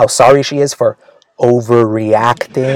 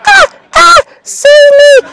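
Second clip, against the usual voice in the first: shrill mock scream; 7 dB louder, slower than usual. Girlfriend falling and grabbing.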